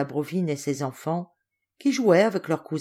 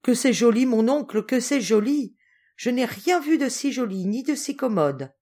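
higher, lively cries of delight; delighted exclamation; 6 semitones higher than usual. A narrator's reading. Niece admiring the new rooms to her uncle, delighted exclamation. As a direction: exclaim it with girlish enthusiasm, pitched up. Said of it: "en s'écriant".

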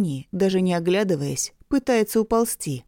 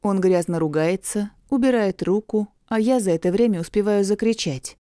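plain reading voice

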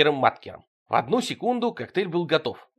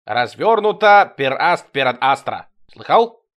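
loud, slow offended outburst; offended alien booming his identity; offended declaration; 2 semitones higher, 8 dB louder than usual. Acted. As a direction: proclaim it loud and slow, wounded pride. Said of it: "обиделся Тимоха".

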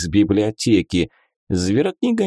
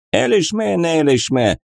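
gibberish migrant voice; 7 semitones higher than usual. Acted. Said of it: "Jamshut gibberish".

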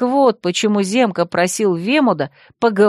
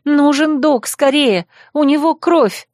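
panicked call; 6 semitones higher than usual. Acted. Girl begging the boys to fetch a doctor, panicked and higher. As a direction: beg for help in panic.

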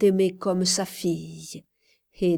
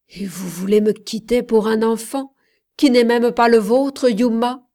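a loud indignant outburst; cried out; 3 semitones higher, 7 dB louder than usual. A narrator's reading. Man outraged she'd stay for a child, crying out. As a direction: shout his disbelief at her. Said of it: "s'écria Gabriel".

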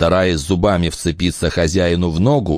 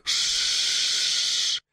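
snake hiss; 8 dB quieter than usual. Acted. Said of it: "Так шипит змея".

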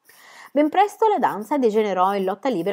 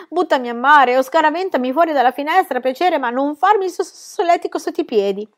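higher, laughing plea; being tickled; 8 semitones higher, 5 dB louder than usual. A narrator's reading. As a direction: beg through ticklish laughter. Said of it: "grugnì".

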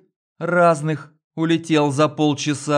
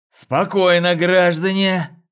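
breathless shout; 5 semitones higher, 4 dB louder than usual. A narrator's reading. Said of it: "задыхаясь, крикнул".

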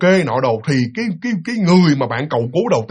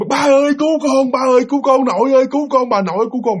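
high, panicked repeated pleas; acted child's frantic cries; screaming for help; 6 semitones higher than usual. Terrified child calling dad and grandparents, screaming for help. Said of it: "Bạn la úm sùm trời đất hết trơn".

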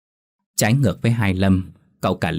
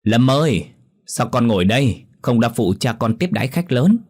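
higher, louder call across the yard; 4 semitones higher than usual. Acted. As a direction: call out to her son from a distance. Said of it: "vừa gọi lớn".

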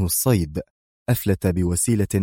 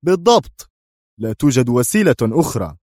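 firm; 3 semitones higher, 3 dB louder than usual. A narrator's reading. Boss agrees no other way exists, firmly. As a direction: confirm it with crisp finality. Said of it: "ثم قال في حزم".

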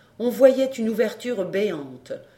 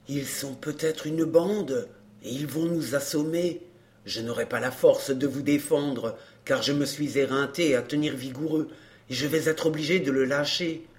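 worried helper; 6 semitones lower than usual. A narrator's reading. Courrapier warning mademoiselle he is too tired, worried. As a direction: plead for retreat in a low pitch. Worn-out helper urging retreat.

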